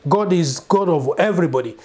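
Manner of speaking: normal talking voice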